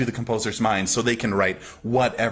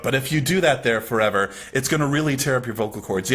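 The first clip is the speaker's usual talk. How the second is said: ordinary talk, nothing marked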